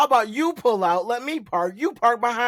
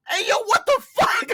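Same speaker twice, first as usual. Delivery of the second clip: high, angry yell at the driver; yelling in the street. Retold or imitated. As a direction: shout it across the street, pitched way up. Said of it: "I'm yelling in the street".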